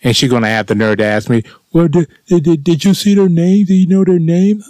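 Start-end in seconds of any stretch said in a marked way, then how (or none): 1.7-4.7 much higher, flustered mock voice of the assistant manager. Angry customer mimicking the assistant manager's dumb question, high and flustered. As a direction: stammer it out in a high, prissy imitation.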